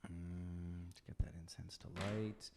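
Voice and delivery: normal talking voice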